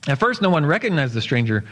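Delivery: usual speaking voice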